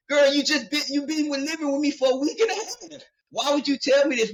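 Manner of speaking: high, disbelieving comeback with a laugh; incredulous. Retold or imitated. Disbelieving, laughing reply to his sister.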